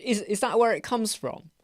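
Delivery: ordinary talk, nothing marked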